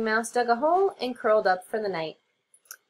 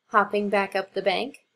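ordinary delivery, nothing marked

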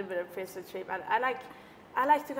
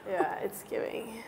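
whispering; 8 dB quieter than usual. Real, not acted. Embarrassed admission.

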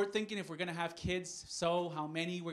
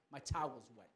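ordinary talk

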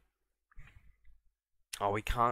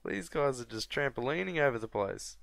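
ordinary talk

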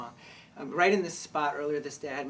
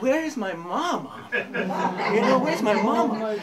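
higher, louder calf voice with laughter; laughing as a baby calf calling for its mama; lost little calf; 6 semitones higher, 7 dB louder, slower than usual. Retold or imitated. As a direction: cry for mama like a newborn calf. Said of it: "And I'm like".

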